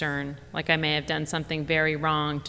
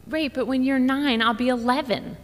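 put-on much higher little-girl voice, know-it-all; big sister setting the record straight; high kid voice, pleased with herself; smug child; 9 semitones higher, 3 dB louder than usual. Georgia correcting her sister.

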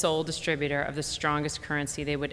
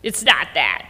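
snapping; 7 dB louder than usual. Acted. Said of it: "she snapped".

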